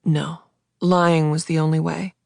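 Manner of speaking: ordinary delivery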